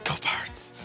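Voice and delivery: normal talking voice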